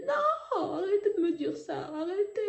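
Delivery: high, pleading cry of refusal, retold; devastated. Daughter begging the doctor to stop, retold devastated cry. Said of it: "J'étais effondrée".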